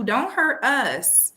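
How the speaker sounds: ordinary talk, nothing marked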